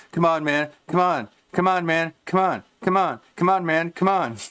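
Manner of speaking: hammed-up high pleading, come on repeated; mock pleading gambler. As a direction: urge the chat on like a desperate gambler. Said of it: "staring at his chat, being like".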